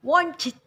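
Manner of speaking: "Wonchit" punched high and loud; open-mouthed O in a forceful "Wonchit"; whole word stressed. Shown as a demonstration. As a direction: hit all of "Wonchit" hard. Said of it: "gunakan O yang mangap ya".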